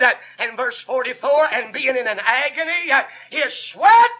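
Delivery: full-force sermon with gasped breaks between phrases; fervent preaching. Real, not acted. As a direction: drive each phrase home to the congregation.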